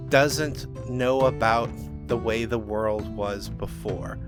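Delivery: ordinary delivery, nothing marked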